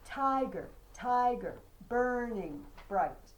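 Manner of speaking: Tiger, tiger, burning bright, strong first syllables, slow and loud; slow chant with loud first beats; trochaic stress. Shown as a demonstration. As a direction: pound out each trochee. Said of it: "opposite of iambic".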